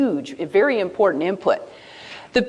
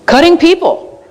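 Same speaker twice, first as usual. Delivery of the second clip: raised, much louder call to the audience; loud prompting; 7 semitones higher, 10 dB louder than usual. Real, not acted. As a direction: throw it out loud and high to the room. Prompting audience, echoing an answer loudly.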